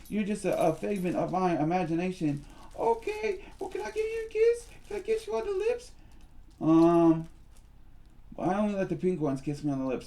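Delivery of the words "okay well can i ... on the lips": very high squeaky doll voice; high-pitched puppet Susie Q; squeaky girl puppet. Acted. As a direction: play a tiny girly doll. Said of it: "we'll call you Susie Q".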